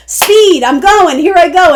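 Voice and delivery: high, loud, eager burst; gung-ho charge. Acted. Reader voices someone running into the ocean, gung-ho. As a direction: charge in with excited momentum.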